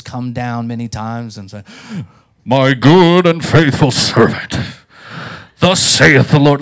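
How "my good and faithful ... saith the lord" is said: loud, slow, grandiose proclamation; booming voice of God. Retold or imitated. God hailing his servant from heaven, mock-majestic boom. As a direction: thunder it down from the opened heavens. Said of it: "heavens open and the angelic forces".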